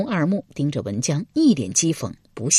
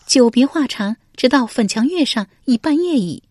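high female character voice; high-pitched lady answering her husband; voiced noblewoman; 7 semitones higher than usual. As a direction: answer in a high, put-on lady's voice.

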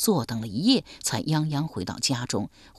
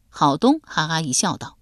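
ordinary delivery

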